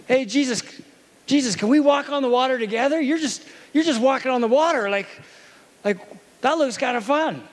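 a calmed disciple, now keen to join in; Peter, no longer afraid, asking Jesus to walk with him; eager Peter. Retold or imitated. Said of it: "changed him from terrified of the situation to".

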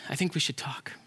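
soft, near-whispered concession; subdued. Acted. Squirrel agrees to talk with God, subdued. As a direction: give in quietly.

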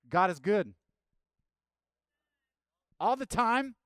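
loud, high call to the crowd; preacher's shout. Real, not acted. Preacher calling out to the congregation, loud and high. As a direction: shout it for the church to answer.

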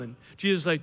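ordinary delivery

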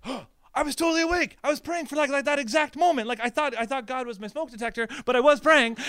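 high, loud amazed outburst; astonished realization. Retold or imitated. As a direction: blurt it out, stunned by the coincidence. Retelling his reaction to the news.

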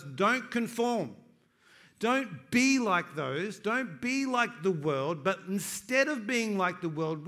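slow, heavy preaching, each word laid down; slow emphatic preaching. Acted. Pastor pressing the Bible's command on his church, slow emphatic preaching. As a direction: lean on every word of the command.

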